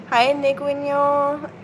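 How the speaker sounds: slow sweet greeting to a beloved pet; sweeter pet voice. Real, not acted. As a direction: coo a sweet hello to the pet. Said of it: "I just usually talk a bit sweeter voice to Lady".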